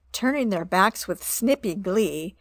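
ordinary delivery, nothing marked

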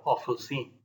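plain reading voice

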